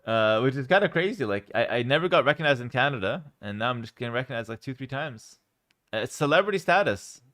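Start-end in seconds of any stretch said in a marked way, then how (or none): none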